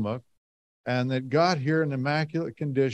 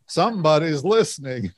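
laughing; 5 semitones higher, 6 dB louder than usual. Real, not acted. Realizing the crowd is listening.

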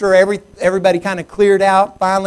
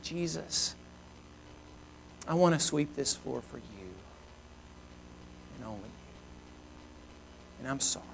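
very soft, low, humble praying; retold quiet apology to Jesus; hushed prayer; 5 semitones lower, 19 dB quieter, slower than usual. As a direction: pray it slowly, fading to a whisper. Retells his prayer on his knees.

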